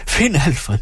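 high-pitched, alarmed call at normal volume; screamed cry for help. A narrator's reading. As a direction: call out in panic without full volume. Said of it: "skreg hun". (skingert, forskrækket råb om hjælp)

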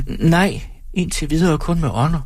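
put-on high, childlike woman; small thin voice, pitched well up; childish thin voice. A narrator's reading. Timid audience member answers the performer, childish thin voice. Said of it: "Hendes stemme er barnlig og tynd".

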